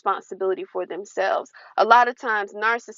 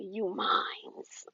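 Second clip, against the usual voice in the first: possessive ex; 12 dB quieter than usual. Retold or imitated. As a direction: claim her like property, quietly. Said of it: "he would say it just like that".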